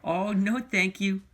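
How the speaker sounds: put-on high girlish no thank you; Lisa. A narrator's reading. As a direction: play Lisa politely declining, high and bright. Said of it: "Lisa answered".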